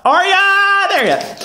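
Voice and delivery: Arya in a very high, stretched cry; mock calling out. Recapper playfully hailing the character, Arya yelled high. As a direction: call out Arya high and long. Calls the name out loudly.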